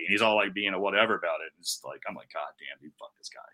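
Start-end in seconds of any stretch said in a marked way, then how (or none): 2.3-3.4 very low, hushed cursing; retold seething. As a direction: grumble it under your breath, mad. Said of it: "I was so mad".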